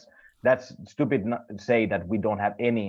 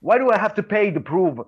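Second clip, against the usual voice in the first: higher and louder, exasperated why; heated rant; 5 semitones higher, 4 dB louder than usual. Real, not acted. Friend ranting about paying for tests, higher exasperated. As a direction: demand why, fed up and rising.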